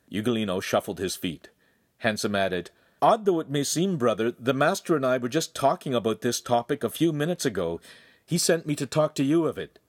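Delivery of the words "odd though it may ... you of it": higher, lively explanation; lightly voiced chatty young man; affable brother. A narrator's reading. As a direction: break news lightly to a brother. Voices Handsome addressing his brother.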